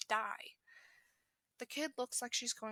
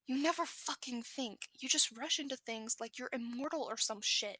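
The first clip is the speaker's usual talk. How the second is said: yelling; 2 semitones higher, 5 dB louder than usual. Acted. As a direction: chew him out at full volume. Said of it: "Katsuki is now yelling".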